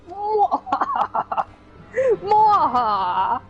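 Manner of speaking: slow hammed-up evil cackle; slow evil laugh. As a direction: cackle like a cartoon villain. Villain laugh.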